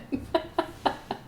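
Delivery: breathy burst of laughter; laughing. Real, not acted. Auctioneer dreading an Irish accent attempt, laughing. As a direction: crack up before attempting the accent.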